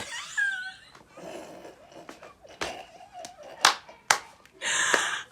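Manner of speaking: high shrieking laugh; shrieking laugh at the memory; loud laugh. Real, not acted. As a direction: burst out laughing at herself. Laughs at own retelling.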